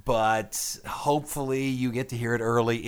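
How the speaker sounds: normal talking voice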